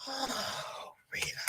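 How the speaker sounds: breathy whisper of pity; whispered sympathy. Real, not acted. Host whispering sympathy to a viewer in hospital. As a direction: breathe her name in sorrow.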